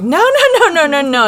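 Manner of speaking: high, loud hammed-up string of nos; a dramatic stop-stop-stop; mock exasperated no. [name] correcting Josie's walk, loud mock dismay.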